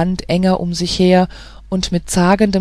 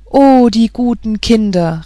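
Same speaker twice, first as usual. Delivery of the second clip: higher, louder, touched cry; moved exclamation; 4 semitones higher, 4 dB louder than usual. A narrator's reading. Märchen, moved by the children, cries out. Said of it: "rief Märchen bewegt aus".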